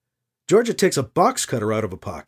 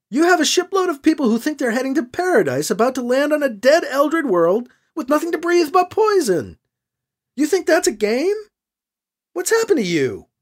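higher, indignant and urgent; rising anger at a shocking betrayal; growing angry; 10 semitones higher than usual. A narrator's reading. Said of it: "growing angry".

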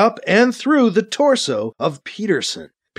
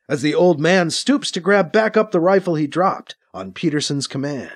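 ordinary delivery, nothing marked

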